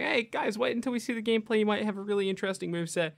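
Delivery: bright rushed plea for patience; eager reassurance. Retold or imitated. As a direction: sell it, like rallying skeptical friends. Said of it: "it's like".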